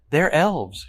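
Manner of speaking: "elves" pitched high and leaned on; emphatic correction. A narrator's reading. Evelyn correcting the word midgets, heavy stress on "elves". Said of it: "she stresses".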